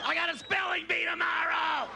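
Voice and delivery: put-on high, drawn-out kid's scream; little kid yelling. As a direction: shriek it slowly like a small child. Little kid yells a complaint.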